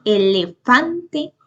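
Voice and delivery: "elefante" with FAN lifted; teacher's demo, "e-le-FAN-te"; stress on FAN. Shown as a demonstration. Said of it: "lleva el acento en fa".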